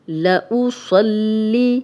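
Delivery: slow, drawn-out tajweed recitation of لأصلی with heavy ص; Quranic recitation. Shown as a demonstration. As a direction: recite لأصلی slowly, heavy ص joined to lam. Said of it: "صاد موٹا پڑھیں گے".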